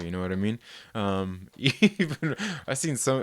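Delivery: ordinary delivery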